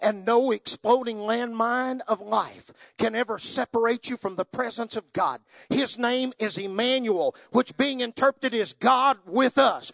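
full-force preaching. Acted. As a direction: thunder the closing promise. Preaching climax on God's presence.

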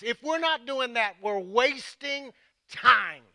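high, forceful preacher's delivery; urgent preaching. Real, not acted. Pastor warning that time is short, urgent preaching. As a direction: drive each phrase home with weighty pauses.

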